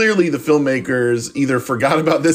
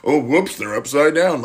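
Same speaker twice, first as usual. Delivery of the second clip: higher, hammed-up fumbling spy voice; mock confused goofball, pitched up; bumbling spy; 6 semitones higher than usual. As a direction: play a clueless spy baffled by his binoculars. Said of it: "comically figure out binoculars".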